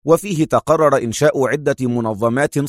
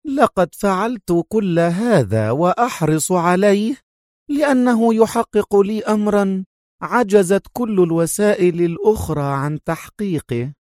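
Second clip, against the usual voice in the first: high, fierce villainess voice; savage ring; 7 semitones higher than usual. A narrator's reading. Sonia tells Adham why she does it, savage ring. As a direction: press each phrase with high, savage intent. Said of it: "صوتها يكتسب رنة وحشية".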